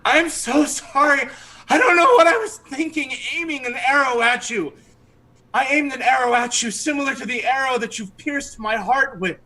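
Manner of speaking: high, swooning, pleading voice; charmed archer begging forgiveness, high and swooning; lovestruck archer. Acted. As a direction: gush the apology, smitten and breathless. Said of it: "he's like".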